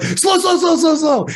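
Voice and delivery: high, urgent repeated slow; panicked pleading. Retold or imitated. Dad teaching son to drive, panicked high pleading. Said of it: "I'm like".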